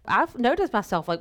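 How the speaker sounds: normal talking voice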